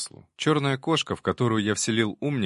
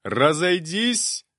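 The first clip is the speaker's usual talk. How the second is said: loud, drawn-out, higher command; barking order; 8 semitones higher, 5 dB louder, much slower than usual. A narrator's reading. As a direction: bark it at the crowd. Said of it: "рявкнул".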